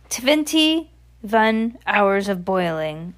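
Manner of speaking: very slow, drawn-out witch voice; accented witch. Acted. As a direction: stretch it out with a put-on witch accent. Voices the Grand High Witch.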